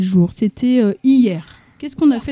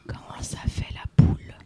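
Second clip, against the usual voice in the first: whispering; 10 dB quieter than usual. Shown as a demonstration. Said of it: "quand on parle tout bas comme ça".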